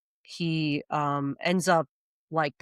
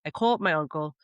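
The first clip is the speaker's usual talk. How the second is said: ordinary talk, nothing marked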